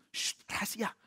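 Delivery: unvoiced, urgent shushing whisper; hushed warning. Bandit warning priest to keep the secret, whispered. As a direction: whisper it to shut him up.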